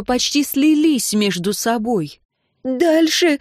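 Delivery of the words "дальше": high-pitched squealed demand; shriek. A narrator's reading. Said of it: "взвизгнула Энн".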